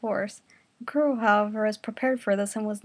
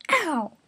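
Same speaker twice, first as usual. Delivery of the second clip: pained yelp; 6 semitones higher than usual. Acted. Hand hits his own crotch.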